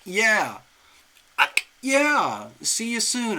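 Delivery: high, loud delighted yeah; thrilled. Real, not acted. Host to Joanna who just booked, thrilled. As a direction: sing out a happy confirmation, pitch falling. Thrilled reply to the new client.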